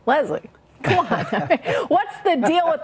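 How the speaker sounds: real chuckle breaking into the question; amused, laughing challenge to a guest. Real, not acted. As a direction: laugh through it, teasing disbelief. Amused reaction to played clip.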